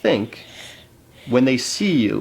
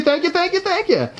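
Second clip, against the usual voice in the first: much higher silly thank-yous; high squeaky voice. Pretend.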